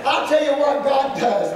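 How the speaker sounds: usual speaking voice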